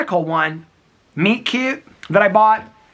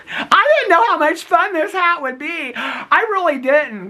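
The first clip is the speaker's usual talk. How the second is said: slow, higher, laughing giddiness; giggly delight; 11 semitones higher, slower than usual. Real, not acted. Giddy over a funny hat.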